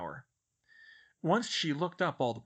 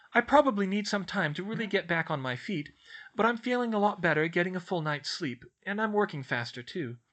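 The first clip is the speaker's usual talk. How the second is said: put-on higher, earnest girl's voice; assuring; 6 semitones higher, 4 dB louder than usual. A narrator's reading. Schoolgirl reports progress to her professor, assuringly. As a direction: reassure them that all is improving. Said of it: "she assured her".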